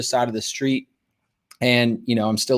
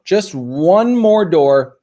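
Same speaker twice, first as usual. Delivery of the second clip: ONE MORE stretched out high and loud; insistent stress; 5 semitones higher, 5 dB louder, much slower than usual. Retold or imitated. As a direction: draw out ONE MORE slowly, voice raised. Mom insists on one last door.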